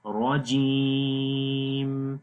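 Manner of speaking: heavy را, then جیم held very long; lengthened jeem. Shown as a demonstration. Teacher showing where to lengthen, جیم drawn out long. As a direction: stretch the 'ee' of جیم slowly. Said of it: "اس لیے یا کو لمبا کریں گے".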